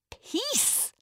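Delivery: fierce lioness roar. A narrator's reading. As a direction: hurl the word back at him. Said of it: "roared Zena back at him".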